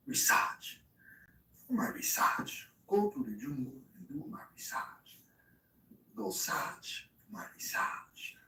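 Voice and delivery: hushed, voiceless mock-accent whisper; whispered character voice. Streamer muttering nonsense to himself, whispered character voice. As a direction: whisper it slyly in a put-on voice.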